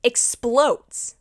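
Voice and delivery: normal talking voice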